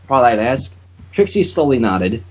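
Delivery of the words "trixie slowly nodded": plain reading voice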